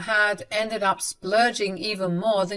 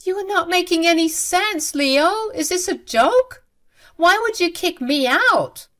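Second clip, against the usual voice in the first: disbelieving protest; 10 semitones higher, 5 dB louder than usual. Acted. Mother pleads disbelieving.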